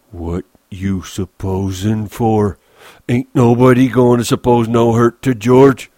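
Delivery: slow question building to loud warning; menacing Lennie. Acted. Lennie stands over Crooks, angrily protecting George.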